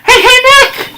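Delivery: calling out. Acted. Calling out to Nick.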